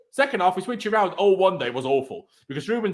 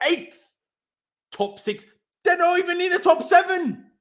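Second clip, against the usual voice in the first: raised-voice fury at losing to Arsenal; angry shouting; 8 semitones higher than usual. Real, not acted. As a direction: rail at the club at the top of your voice. Furious rant about losing to Arsenal.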